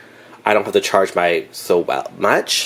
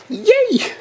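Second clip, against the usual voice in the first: excited. Real, not acted. Excited cheer over bargain.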